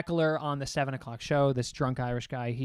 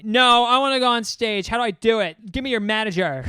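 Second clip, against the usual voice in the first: higher, louder insistent demand; drunk woman; 6 semitones higher, 7 dB louder than usual. Retold or imitated. A drunk patron to the host, high loud demands. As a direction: demand the manager, drunk and pushy. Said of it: "she is so drunk".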